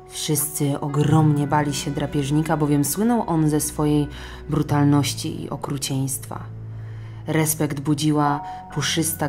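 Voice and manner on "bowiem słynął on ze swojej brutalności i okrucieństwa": ordinary delivery, nothing marked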